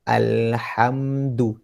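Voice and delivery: slow, drawn-out "Alhamdu"; slowly. Shown as a demonstration. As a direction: stretch each syllable out slowly. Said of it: "slowly, slowly". (baca pelan-pelan)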